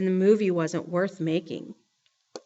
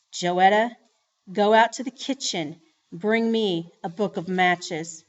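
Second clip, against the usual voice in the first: usual speaking voice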